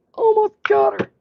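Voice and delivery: high, eager cheer of effort; rising thrill, nearly there; excited. Real, not acted. Scrubbing off stubborn grit.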